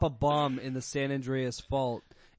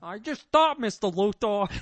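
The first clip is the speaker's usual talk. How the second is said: dopey henchman voice; 6 semitones higher, 7 dB louder than usual. Retold or imitated. Redoing Otis voice.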